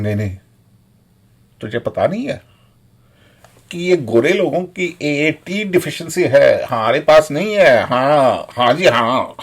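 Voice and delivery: fast, dismissive mimicry of know-it-alls; mocking smug Indians, fast; mocking quote. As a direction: rattle it off like a smug uncle. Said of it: "people were saying".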